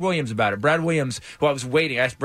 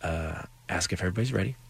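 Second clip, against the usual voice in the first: much softer, lower aside; leaning in, hushed; 7 semitones lower, 8 dB quieter, faster than usual. Retold or imitated. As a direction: murmur it privately into his ear. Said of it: "Bryan leans in and he goes".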